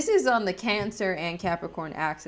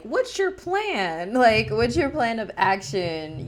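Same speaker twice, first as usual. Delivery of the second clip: demanding Capricorn; 4 semitones higher, 3 dB louder, slower than usual. Retold or imitated. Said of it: "Capricorn is more about, like".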